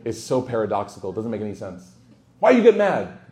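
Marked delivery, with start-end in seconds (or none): 2.4-3.3 high, loud mock outrage; exaggerated loud, high-pitched challenge; mock indignant protest. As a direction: act out an indignant friend, voice jumping up. Acted quarrel with an upset friend.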